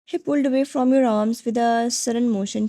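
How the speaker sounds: ordinary delivery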